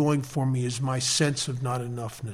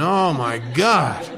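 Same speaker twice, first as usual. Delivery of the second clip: slow mock despair; 3 dB louder, much slower than usual. Real, not acted. Exasperated at all the service.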